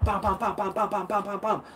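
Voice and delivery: quick rhythmic mock punch noises; punch sounds. Shown as a demonstration. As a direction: beat out fast jabs with your voice. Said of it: "le plus de coups de poing possible". (bruitage rythmé de coups rapides)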